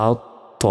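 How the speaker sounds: "আত ত" with the t stopped, then sounded afresh; "আত ত" in two deliberate beats on a double t; doubled consonant. Shown as a demonstration. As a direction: hold the closure between "আত" and "ত". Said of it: "তাজদিদ থাকার কারণে দুইবার উচ্চারণ হচ্ছে ত".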